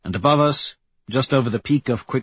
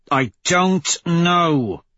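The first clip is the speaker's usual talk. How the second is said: drawn-out, higher-pitched impatient retort; 7 semitones higher, 4 dB louder, much slower than usual. A narrator's reading. Severo answering the narrator about the bombing, impatiently. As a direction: stretch DON'T and KNOW out with impatience. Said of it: "Severo says impatiently".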